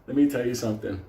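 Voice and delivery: ordinary delivery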